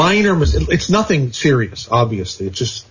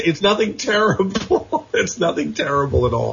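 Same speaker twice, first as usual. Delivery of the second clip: laughing; 4 semitones higher than usual. Real, not acted. Laughs while reassuring Aaron.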